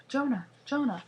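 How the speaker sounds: loud high call across the roof; calling to her brother, loud and high. Acted. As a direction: shout his name to reach him.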